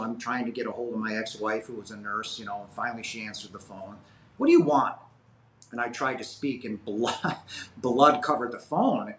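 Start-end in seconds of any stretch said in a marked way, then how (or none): 4.4-5.0 louder, higher irritated snap; curt answer. Retold or imitated. Ex-wife answering his call, irritated. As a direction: pick up the phone annoyed.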